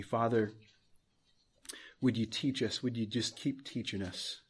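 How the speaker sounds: low, soft, unhurried praying; closing prayer. Real, not acted. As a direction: pray softly, pausing between phrases.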